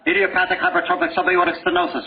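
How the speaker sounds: high-pitched rattled-off medical term; mock-frantic recital of a diagnosis; over-intense term. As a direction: fire off the jargon in an overexcited rush. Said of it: "Whoa, take a, get, relax, buddy".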